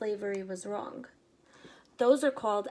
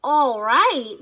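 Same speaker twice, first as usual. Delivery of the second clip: loud answer; 4 semitones higher, 12 dB louder than usual. Acted. Henry answering from the box.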